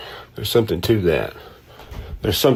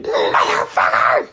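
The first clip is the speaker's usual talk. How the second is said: strained, high-pitched fake rage; hammed-up, drawn-out furious squeal; mock enraged outburst; slower than usual. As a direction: burst out like their blood pressure is spiking. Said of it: "their blood pressure goes up".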